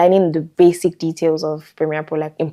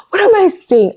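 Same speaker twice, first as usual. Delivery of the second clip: flustered self-quote; 14 semitones higher, 4 dB louder than usual. Retold or imitated. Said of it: "And I'll just be like".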